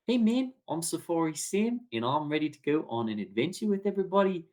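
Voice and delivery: put-on breezy New Zealand accent for an adventure guy; Safari Sam New Zealand accent. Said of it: "I can just kinda talk like this".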